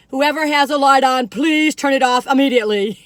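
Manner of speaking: loud, strict guide announcement; stern tour guide. Retold or imitated. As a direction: bark the order at the whole group. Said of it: "you hear this tour guy going".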